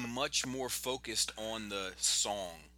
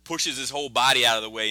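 usual speaking voice

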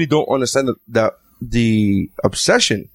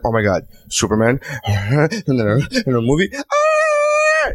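mock giddy; 3 semitones higher, slower than usual. Husband parodying wife's giddiness over Superman, mock giddy. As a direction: start near normal, end in a drawn-out mock squeal. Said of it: "I could get all giddy".

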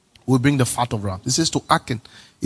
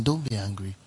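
soft reminder; 4 semitones lower, 8 dB quieter than usual. Shown as a demonstration. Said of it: "tell the person".

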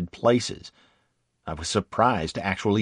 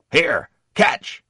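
loud, higher quick call; 7 semitones higher, 6 dB louder than usual. Acted. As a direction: shout it as you throw. Sergeant tosses ammo belt.

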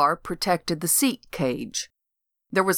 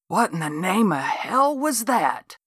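a touch of a gruff lawman; lightly voiced cop; 2 semitones higher than usual. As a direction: ask it with mild disbelief. Boots the cop asks.

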